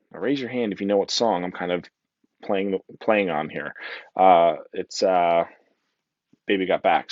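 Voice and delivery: normal talking voice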